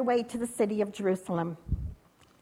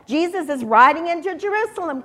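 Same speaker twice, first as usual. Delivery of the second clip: raised, higher announcing call; 5 semitones higher, 7 dB louder than usual. A narrator's reading. One man announcing Jesus to the crowd, calling out. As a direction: call the news down the street. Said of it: "called one man".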